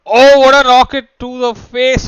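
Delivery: high, loud, slow burst of hype; excited caster yell. Real, not acted. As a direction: erupt at the big play. Caster reacts to big play.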